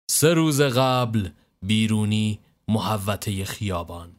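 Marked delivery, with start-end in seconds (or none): none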